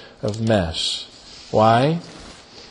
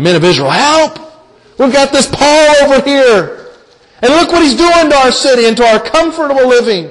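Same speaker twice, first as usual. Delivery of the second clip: raised, higher cry of an angry mob; mob yelling; 6 semitones higher, 3 dB louder than usual. Acted. Preacher voicing the Jerusalem mob, loud alarmed yell. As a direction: call out like a rioting crowd. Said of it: "They're, they're yelling".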